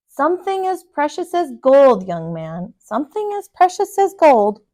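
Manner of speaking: put-on big, loud, grand old man; booming giant voice. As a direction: boom it out proudly.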